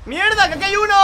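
startled shout. Real, not acted. Gamer startled by a bus on the track. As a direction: yelp at the sudden obstacle. Suddenly spots a bus ahead.

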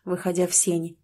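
usual speaking voice

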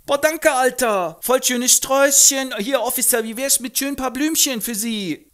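sehr hoch, laut, prollig; Straßengöre. Acted. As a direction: patzige Göre im Jugendslang. Eliza verkauft Blumen. (hohe, laute Prollstimme)

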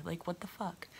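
a sharp whisper under her breath; breathy aside, barely voiced; whispered disbelief. Retelling her reaction to tweets.

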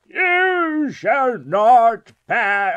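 slow, high, loud proclamation; grand, stretched shout of defiance; mock wizard bellow. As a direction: draw out each word like a hammed-up wizard. Mock wizard challenge to a trespasser.

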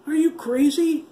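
put-on terrified boy refusing to chase zombies; shocked cry. A narrator's reading. Panicked high shout. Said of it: "Keith cried".

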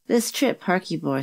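ordinary delivery, nothing marked

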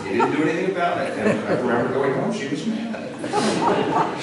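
laughing through the words, high and loud; can't stop laughing while telling it; giggling at the memory. Real, not acted. Laughing while retelling dad's fix.

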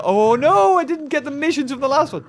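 high, loud outburst of regret; dismayed. Real, not acted. Player realising he missed the missions, loudly dismayed. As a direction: blurt it out as you realise the slip.

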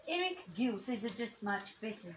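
ordinary talk

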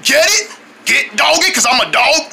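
usual speaking voice

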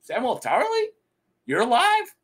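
high, incredulous question; mock surprise. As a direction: act stunned he survived. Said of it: "And Leo Tyrell's like".

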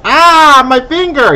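high, loud hammed-up fright; mock scared yell. Man scared of his own finger, mock yell. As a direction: cry out at your own finger. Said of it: "man points and goes".

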